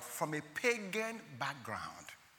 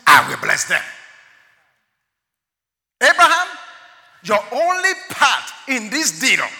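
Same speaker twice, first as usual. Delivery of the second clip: preaching at full force; 10 semitones higher, 12 dB louder than usual. Acted. Preacher voicing God calling Abraham, at full force.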